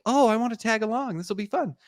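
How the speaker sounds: high-pitched, mock-enthusiastic tag-along; eager fiancee. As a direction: put on a high, keen girlfriend voice. Fiancee's eager line, voiced in retelling.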